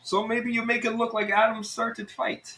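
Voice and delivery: high-pitched Russian schemer; Russian accent. Acted. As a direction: suggest it slyly in a Russian accent. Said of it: "it's Russian".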